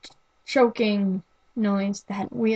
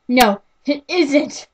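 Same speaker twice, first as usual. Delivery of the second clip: slow, much louder tearful protest; crying out; 4 semitones higher, 6 dB louder than usual. Acted. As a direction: burst out in a hurt, slow cry.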